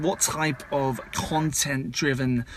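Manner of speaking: ordinary talk, nothing marked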